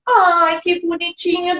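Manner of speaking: sugary praise for an infant; syrupy baby talk. Acted. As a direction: gush over a baby like a fond grandparent. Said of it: "essa voz toda melosinha".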